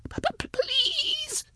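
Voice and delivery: tiny, extremely high stuttered plea; slow, soft dying squeal; gurgled. A narrator's reading. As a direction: gurgle out a faint, broken please. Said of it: "Jaff gurgled".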